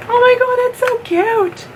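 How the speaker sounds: high, loud gushing over something cute; excited squeal. Real, not acted. Opening a gift box, she gushes in a squeal. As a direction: squeal with delight. Delighted by panda eye base.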